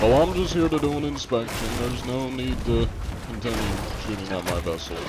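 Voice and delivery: prim official inspector voice; fussy bureaucrat keeping it courteous; polite inspector. Pretend. Inspector role talking to enemy pirates.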